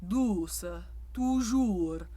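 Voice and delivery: douce and toujours with an inner-mouth oo; French oo words. Shown as a demonstration. Coach modelling French oo words for singers. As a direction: toujours with a round, forward French oo. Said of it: "To really sing well".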